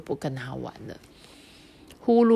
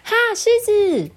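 much higher, loud playful shout; loud cry; 6 dB louder than usual. Acted. Mimi shouting her game idea to everyone, loud. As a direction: call it out suddenly. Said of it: "咪咪就突然大声地说".

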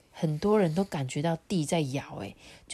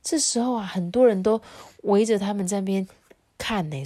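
usual speaking voice

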